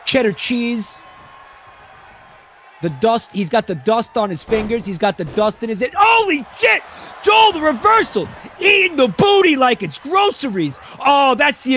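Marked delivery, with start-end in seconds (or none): 6.0-11.7 high, loud shouted call; frenzied play-by-play. Pretend. Commentator calling Joel's reversal to the crowd, frenzied shout. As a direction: erupt at the sudden reversal.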